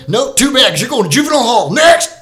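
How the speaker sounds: hammed-up judge shouting out a sentence; high, loud mock ruling, building to a yell; bellowing judge. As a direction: bark it out like an impatient judge. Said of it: "the judge is like".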